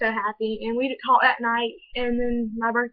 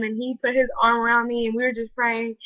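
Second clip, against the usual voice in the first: usual speaking voice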